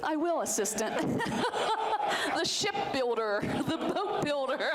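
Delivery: words spilling out through real laughter; giggling as she jokes about the assistant; laughing. Real, not acted. Guest laughing with the host about his assistant, giggling.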